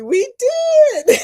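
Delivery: high, laughing burst. Real, not acted. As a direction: laugh it out, delighted. Laughing recalling duck hiding.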